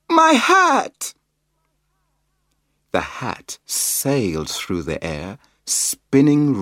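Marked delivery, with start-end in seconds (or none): 0.1-1.1 high, loud wail for the hat; yelling. A narrator's reading. Biff cries out for her hat, loud high yell. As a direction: cry out in dismay. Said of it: "Biff yelled".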